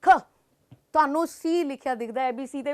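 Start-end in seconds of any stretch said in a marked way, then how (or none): 0.0-0.2 short aspirated "ਖ"; single airy "ਖ" burst; K sound. Shown as a demonstration. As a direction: puff out "ਖ" crisply. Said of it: "next group".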